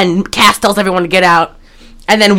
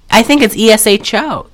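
ordinary talk

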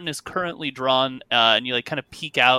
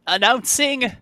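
high-pitched reedy announcer; reedy man's voice; 10 semitones higher, slower than usual. Acted. Reedy man announces the act from the stage, high voice. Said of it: "you hear the ready man's voice".

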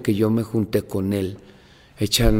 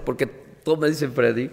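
ordinary delivery

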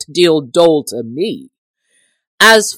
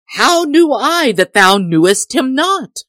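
fearful pleading; 8 semitones higher, 5 dB louder than usual. A narrator's reading. Host pleads in fear.

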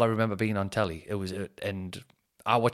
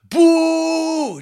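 high, loud jeering boo; the crowd turns on the comic; mock crowd boo; 11 dB louder than usual. Crowd booing the bad opener.

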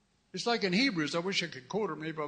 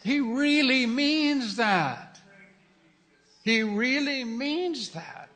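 slow emphasis; 5 semitones higher, 4 dB louder, much slower than usual. Real, not acted.

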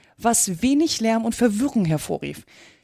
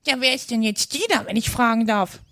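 put-on parrot voice, raised pitch; inquisitive parrot; 3 semitones higher than usual. A narrator's reading. Parrot asks Alice who Dina is, inquisitive. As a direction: ask archly as a high-voiced bird. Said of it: "sagte der Papagei".

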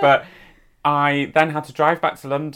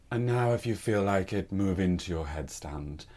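low, hushed yoga-teacher voice; quiet, low instruction of a yoga teacher; 6 semitones lower, 9 dB quieter than usual. Retold or imitated. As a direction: softly guide the class, pitched well down. Yoga teacher quoted in a story.

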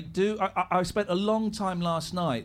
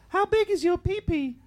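man doing a woman's voice; 8 semitones higher than usual. Pretend. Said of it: "Can anyone do a really good girl's voice?".

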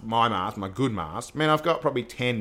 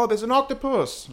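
imitating the son; 12 semitones higher than usual. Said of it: "I hear him go".

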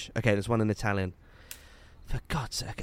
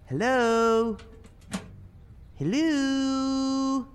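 calling out; 13 semitones higher, much slower than usual. Real, not acted. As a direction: call in to the house. Calling through the letterbox.

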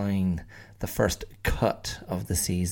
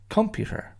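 computer with a much higher peak; stress on middle syllable. Shown as a demonstration. Showing students how to stress each syllable. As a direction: leap up on one syllable of computer. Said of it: "stress each syllable in turn".

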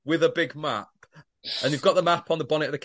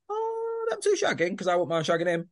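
much higher, softer, simpering mock voice; mocking Flick; 5 semitones higher, 4 dB quieter than usual. Mocking Flick as she eyes up Stu, high and simpering. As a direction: coo it like a scheming flirt. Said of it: "Now she's like".

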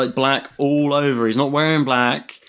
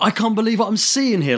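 high-pitched mimicked disbelief; incredulous; 8 semitones higher than usual. Imitating the caller to show his genuineness, incredulous. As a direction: play the caller stunned by the sight. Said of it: "incredulous at what he".

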